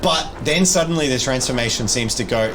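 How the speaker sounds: normal talking voice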